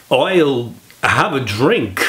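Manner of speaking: slow, halting Walken-style repeat; mock celebrity pupil botching the accent; Christopher Walken impression. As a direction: drag the line out stiffly, missing the Irish. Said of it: "Christopher, I want you to repeat after me".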